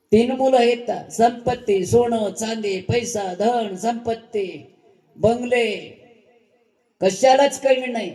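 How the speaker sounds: put-on proud old man listing wealth.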